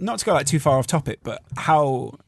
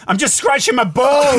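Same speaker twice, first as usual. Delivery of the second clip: mock blurt; 10 semitones higher, 4 dB louder than usual. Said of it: "You're going".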